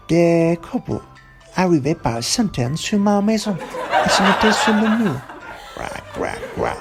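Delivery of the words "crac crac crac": whispered scratchy crac crac crac; crow scratching noise. Retold or imitated. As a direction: make the crows' claws scratching. Crows scratching the wall.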